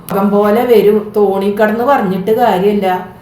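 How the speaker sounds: plain reading voice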